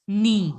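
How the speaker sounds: "Knee" for French nid, ending on the vowel; silent final D. Shown as a demonstration. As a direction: model "Knee" with the D left off. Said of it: "don't have to pronounce the D".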